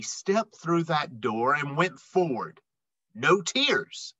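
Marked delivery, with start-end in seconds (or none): none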